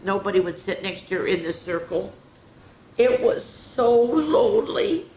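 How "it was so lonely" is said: slow, higher and louder lonely sigh; mournful storybook sadness. Acted. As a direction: draw it out sadly, like a lonely little dinosaur. Sad line in read story.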